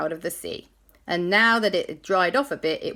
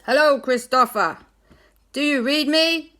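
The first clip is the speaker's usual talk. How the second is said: higher, louder hail; radio captain; 8 semitones higher, 6 dB louder than usual. Acted. Captain Barnacles calling Christopher, loud and high. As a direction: call him up over the radio.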